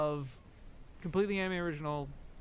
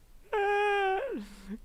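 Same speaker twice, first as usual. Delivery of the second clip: high, loud held wail, mimicked; imitated reaction cry; 5 dB louder than usual. Streamer mocking every person's reaction, high drawn-out cry.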